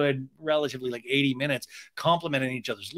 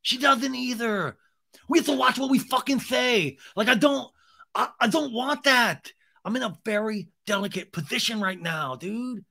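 high-pitched whiny plea; hammed-up pleading bro; 8 semitones higher than usual. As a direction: beg the crew in a strained high voice. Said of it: "So he's pleading".

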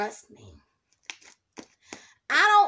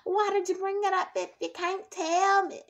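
whiny viewer; 7 semitones higher than usual. Retold or imitated. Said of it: "you guys are probably like".

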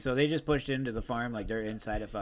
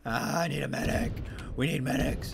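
urgent yell for a medic; man down shout; 7 semitones higher than usual. Real, not acted. Wounded, calling for medic.

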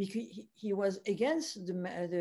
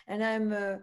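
ordinary delivery

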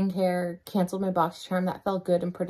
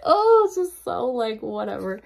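exasperated laughing; 3 semitones higher than usual. Real, not acted. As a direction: laugh helplessly through the frustration. Exasperated laugh about lockdown.

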